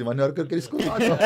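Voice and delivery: half laughing the words out; amused, starting to laugh. Retold or imitated. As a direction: chuckle through the line as you start it. Retelling his near-reply, laughing.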